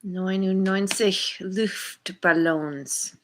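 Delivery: slow, hammed-up mock German accent; terrible German accent. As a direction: drawl the song title in a deliberately bad German accent. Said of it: "terrible German accent".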